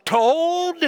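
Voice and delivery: high, stunned disbelief at the tidy room; shocked parent. Pretend. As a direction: ask it like you can't believe your eyes, voice jumping up. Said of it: "you look as if you're somewhat shocked".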